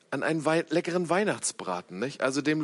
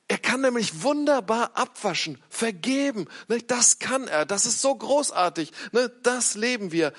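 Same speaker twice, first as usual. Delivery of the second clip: higher-pitched, gushing praise, lightly put on; mock enthusiasm; 6 semitones higher than usual. Preacher voicing Christians' praise of Jesus' forgiveness, mock enthusiasm. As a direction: play up the admiration, pitch lifted.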